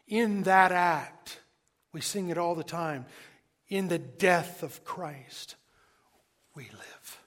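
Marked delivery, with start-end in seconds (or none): none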